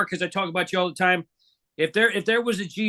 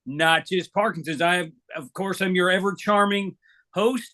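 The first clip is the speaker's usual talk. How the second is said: ordinary delivery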